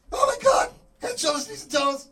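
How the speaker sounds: very high, loud panicked scream; screaming awake. Acted. Teacher wakes screaming beside his wife, panicked scream. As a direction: scream it out as from a nightmare. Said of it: "I wake up screaming at night".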